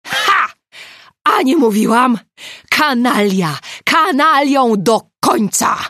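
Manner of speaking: loud, high crowing, slowing at the end; gloating full-voice outburst; triumphant yell. A narrator's reading. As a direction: shout it in vindicated triumph. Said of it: "wrzasnęła triumfalnie".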